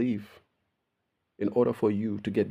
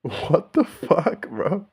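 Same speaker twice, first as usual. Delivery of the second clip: laughing disbelief; 7 semitones higher, much slower than usual. Real, not acted.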